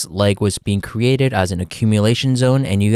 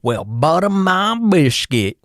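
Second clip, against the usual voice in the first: slow Texan drawl, pitched much higher; Texan exclamation; 8 semitones higher, slower than usual. Pretend. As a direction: stretch out a high, hammed-up Texan "butter my biscuit". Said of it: "'cause of my Texan accent".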